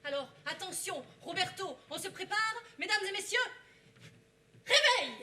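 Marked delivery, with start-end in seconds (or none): 2.8-5.1 loud. Acted. Waking the audience.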